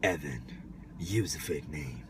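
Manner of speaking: low, slow, dramatic deep voice. Pretend. Mock deep voice, far.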